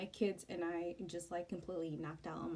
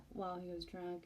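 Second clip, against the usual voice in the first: ordinary delivery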